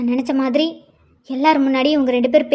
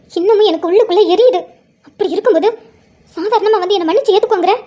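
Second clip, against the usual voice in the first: heated, higher-pitched outburst; hurt anger; 5 semitones higher, 4 dB louder than usual. Acted. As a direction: throw the hurt back at him forcefully. Wife vents hurt at husband. (மனக்காயத்தோடு குரல் உயர்த்திய கோபம்)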